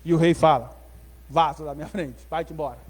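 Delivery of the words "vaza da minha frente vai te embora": low, quiet dismissal; dismissive king. Acted. King sending Neemias away, low and dismissive. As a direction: send him away under your breath.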